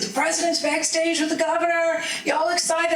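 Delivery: high, bright rally hype; hyping the crowd. Retold or imitated. Speaker retelling how she hyped the rally crowd, excited.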